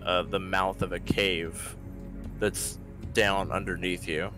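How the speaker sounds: ordinary talk, nothing marked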